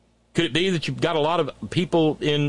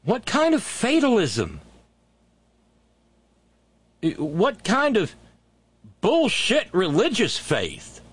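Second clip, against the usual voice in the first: higher-pitched, indignant questioning; exasperated disbelief; 6 semitones higher, slower than usual. Real, not acted. Host questioning their religious fatalism, exasperated. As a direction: draw out each word in disgusted disbelief.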